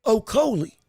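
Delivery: "Okolie" said firmly with an L, no G; "Okolie" with an l sound replacing the g; corrected name. Real, not acted. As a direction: land on the corrected "Okolie", l before the final ee. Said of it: "There's no G in the name".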